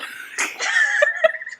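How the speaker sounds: high gleeful laugh; gleeful cackling laugh; big laugh. Real, not acted. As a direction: burst out laughing. Laughs after murder confession.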